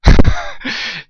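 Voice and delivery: sudden high, loud laugh; burst of laughter. Real, not acted. Sudden laugh during stream.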